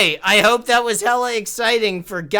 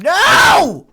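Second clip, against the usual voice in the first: shouting; 11 semitones higher, 10 dB louder than usual. Real, not acted. Clock running out.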